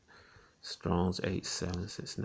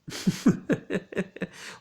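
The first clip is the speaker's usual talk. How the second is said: high breathy laugh; 11 semitones higher than usual. Real, not acted. Laughs at own skit.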